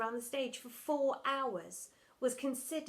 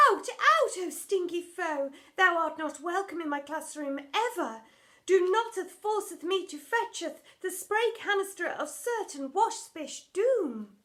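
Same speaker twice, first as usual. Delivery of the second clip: high, loud, overwrought stage voice; hammed-up old-English drama queen; theatrical Shakespearean lament; 6 semitones higher, 7 dB louder than usual. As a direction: wail it like a tragic heroine on stage. Drama teacher banishes a wasp.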